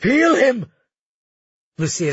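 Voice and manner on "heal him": high desperate shout. A narrator's reading. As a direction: scream the order. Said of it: "he shouted at her".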